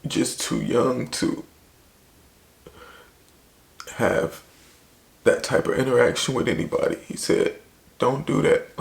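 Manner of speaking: fighting back tears while telling it; tearful. Real, not acted. Voice strained after breaking down.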